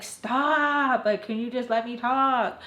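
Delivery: whiny exasperation. Retold or imitated. Daughter interrupting mom's warnings, whiny exasperation. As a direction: imitate a daughter pleading to get a word in.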